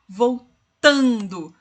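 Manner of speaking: Voltando with TAN stretched long; stress on the penult. Shown as a demonstration. Teacher shows the class the tonic syllable, Voltando with long TAN. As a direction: draw out the middle of Voltando, slowly. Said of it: "ou a mais longa".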